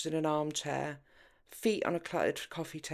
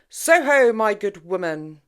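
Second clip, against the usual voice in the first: much higher, much louder grand cry; theatrical booming flourish; grand exclamation; 5 semitones higher, 15 dB louder than usual. A narrator's reading. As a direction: announce it like a showman. Liam leaps up, spins dramatically.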